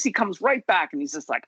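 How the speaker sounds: ordinary delivery, nothing marked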